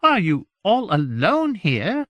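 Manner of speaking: high, loud, slow dismayed question; aghast courtier asking slowly; shocked. Acted. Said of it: "in a shocked voice".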